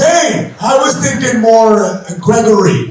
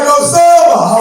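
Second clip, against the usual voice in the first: friend calling his name, louder and higher; called out name; 8 semitones higher, 5 dB louder, slower than usual. Retold or imitated. Friend greeting him across the airport, loud call. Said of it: "He was like".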